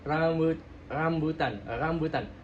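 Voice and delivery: RAMBUTAN with a slack R, tongue not touching; untrilled R. Shown as a demonstration. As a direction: say RAMBUTAN without vibrating the R. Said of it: "Kalau kita bilang nggak bersentuhan dan bergetar".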